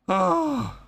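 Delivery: high falsetto "Oh"; a sudden squeak of surprise; startled high yelp; surprised squeak. Real, not acted. Reacting on screen.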